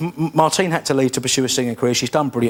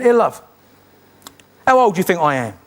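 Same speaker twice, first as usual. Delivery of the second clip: higher, louder hail across a bus stop; cheery call to a stranger; 4 semitones higher, 4 dB louder than usual. Acted. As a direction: call out to get her attention. Calling to lady at bus stop.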